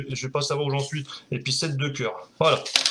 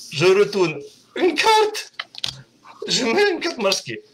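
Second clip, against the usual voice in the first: slow showman patter; 4 semitones higher, slower than usual. Shown as a demonstration. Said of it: "si vous voyez votre carte apparaître".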